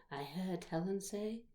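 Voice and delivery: ordinary delivery, nothing marked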